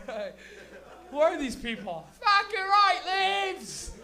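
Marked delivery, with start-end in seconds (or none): 2.2-3.9 high, loud, stretched-out yell; rowdy fan cheer. Acted. Hick fans acted out cheering.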